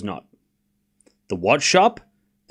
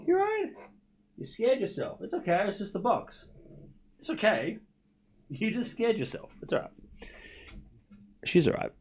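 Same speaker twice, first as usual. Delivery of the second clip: higher, much softer reassurance; soothing pet talk; 6 semitones higher, 12 dB quieter than usual. Real, not acted. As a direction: soothe a frightened pet gently.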